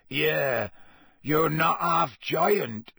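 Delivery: slow, croaking voice; croaky. A narrator's reading. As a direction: croak it out bitterly. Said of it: "said Hagrid croakily".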